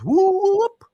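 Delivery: high, loud rising whoop; woman's whooping call, imitated loudly. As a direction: let out a drawn-out whoop climbing sharply. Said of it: "she goes".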